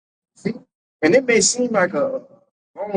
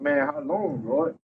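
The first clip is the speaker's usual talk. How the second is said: weary lament; 4 semitones lower, 7 dB quieter, slower than usual. Retold or imitated. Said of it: "It's something we say".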